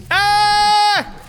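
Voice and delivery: sudden high pained cry of frustration; a real sharp yelp of dismay; frustrated yell. Real, not acted. Frustrated reaction to bad play.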